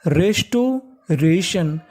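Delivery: Restoration stretched very slowly, syllable by syllable; very slow model word. Shown as a demonstration. Teacher joining the pieces slowly for students. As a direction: draw restoration out at half speed. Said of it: "तो पूरी meaning मिला के पढ़ते हैं".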